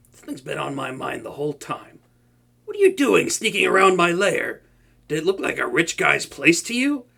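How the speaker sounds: normal talking voice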